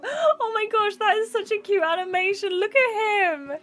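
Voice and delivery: high-pitched squeal over something adorable; cooing in delight. Real, not acted. Streamer melting at a cute guide dog animation, high delighted coo.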